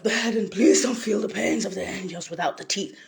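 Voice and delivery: ordinary talk, nothing marked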